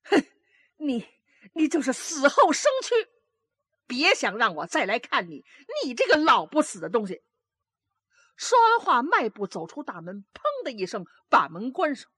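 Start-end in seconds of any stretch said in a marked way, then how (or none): 0.0-7.2 a nasty parting curse, a bit fast at the end; vicious cursing. A narrator's reading. Daughter curses her old mother before storming out. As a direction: spit out the curse, higher and harsh. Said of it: "恶狠狠地骂".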